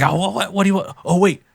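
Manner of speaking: high-pitched mock panic; freaking out. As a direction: imitate someone flustered, pitched well up. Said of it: "she's, like, freaking out".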